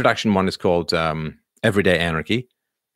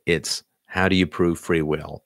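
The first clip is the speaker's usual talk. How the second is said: ordinary talk, nothing marked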